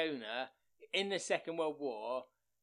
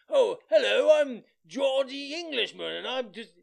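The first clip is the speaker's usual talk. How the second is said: higher, loud, slow mock posh English voice; posh English send-up; 8 semitones higher, 11 dB louder, slower than usual. Mocking the sitcom's stranded English airmen, posh English send-up. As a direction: play a silly upper-class Englishman. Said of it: "the English are all very like".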